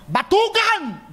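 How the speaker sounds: "Batugan" barked high and stretched out slowly; "Batugan" flung out slowly in a high furious cry; angry rebuke. Retold or imitated. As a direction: hurl "Batugan" at him with scorn. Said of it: "Ganun na ganun".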